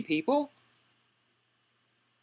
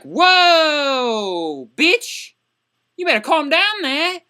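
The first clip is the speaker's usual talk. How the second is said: high, louder indignant comeback; drawn-out shock, then pitched-up telling-off; mock outrage; 4 semitones higher, 4 dB louder, much slower than usual. Student answering the heartless teacher back, mock-outraged.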